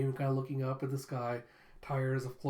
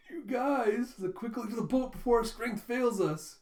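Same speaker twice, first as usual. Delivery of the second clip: melodramatic urgency; 8 semitones higher than usual. Retold or imitated. Damien dramatic rescue plea.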